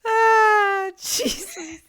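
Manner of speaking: high, loud yelp of real shock; startled cry. Real, not acted. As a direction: jump and cry out at a sudden fright. Startled reaction while watching.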